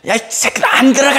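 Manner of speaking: put-on high, furious bark at the kids; angry yell. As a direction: yell the students back into class. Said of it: "막 소리 지르고".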